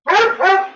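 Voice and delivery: high-pitched burst of laughter; high giggle at the dog. Real, not acted.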